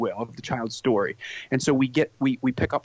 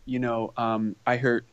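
ordinary talk

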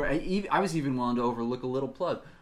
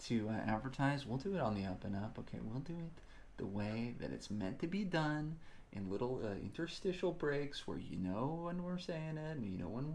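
much softer confidential pitch to Marvel; hushed aside; 9 dB quieter than usual. Acted. Host quietly pitching ads to Marvel, hushed. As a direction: lean in and murmur it privately.

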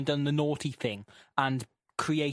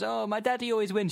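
higher-pitched boasting little piglet; Peppa Pig; 6 semitones higher than usual. Acted. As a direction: lift it into a child cartoon character. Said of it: "And Peppa goes".

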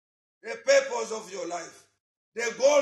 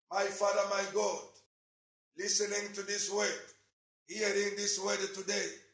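softer prayer over music, repeating pleas; fervent prayer; 2 semitones lower, 6 dB quieter than usual. Acted. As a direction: pray aloud, leading the church to God.

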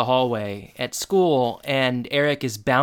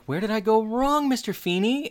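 high-pitched questioning plea; mock dismay; 9 semitones higher than usual. Student asking teacher about his bad grade, mock-dismayed. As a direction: lift it high, like a baffled student.

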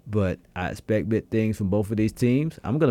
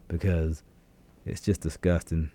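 ordinary delivery

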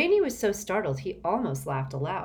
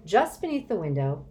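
ordinary delivery

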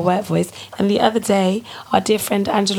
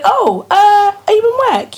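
high-pitched, louder mock phone query; imitating surprised friend; 11 semitones higher, 5 dB louder than usual. Retelling friend's call to the office, high mock surprise. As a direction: mimic a caller taken aback, pitched way up. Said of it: "you said".

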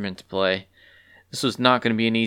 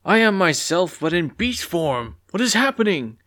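higher, bewildered beast voice; lost in a new body, asking; confused creature; 8 semitones higher than usual. Acted.

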